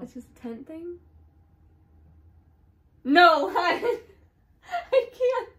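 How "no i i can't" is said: high laugh breaking into fear; nervous laughing fright. Real, not acted. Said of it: "I'm so scared".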